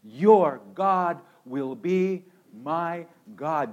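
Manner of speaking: drawn out, every word weighed; slow it right down and land it; slow emphatic vow. Acted. Preacher slows down to make the congregation notice. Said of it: "And watch this".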